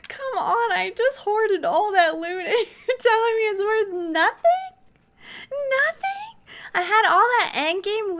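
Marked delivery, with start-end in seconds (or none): 0.1-6.1 high, incredulous complaint; exasperated protest. Real, not acted. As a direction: protest the unfair loss, rising into disbelief. Protesting lost loot after restart.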